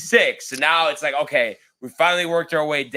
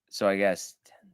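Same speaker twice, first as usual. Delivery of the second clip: counting to himself; 5 semitones lower, 3 dB quieter than usual. Real, not acted. Counting pars to himself from card.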